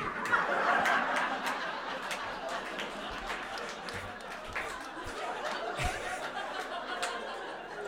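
long high cackling laugh; wheezing giggle fit; high burst of laughter. Real, not acted. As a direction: crack up at your own story. Laughing at the punchline.

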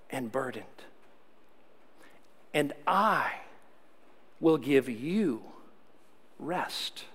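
slow, soft, lingering read; unhurried scripture reading trailing to a whisper. Shown as a demonstration. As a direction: read each phrase slowly, letting it sink in. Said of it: "I'm gonna do it a little slower".

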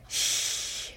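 soft unvoiced hush; quiet the others without a sound; whispered shush. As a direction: Zoe hushing her friends, whispered. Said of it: "Zoe whispers".

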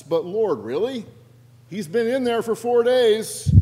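high, loud protest; acted realist's loud objection; incredulous protest. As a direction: object in disbelief, pitch climbing.

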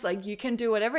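usual speaking voice

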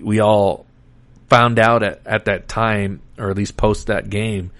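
ordinary talk, nothing marked

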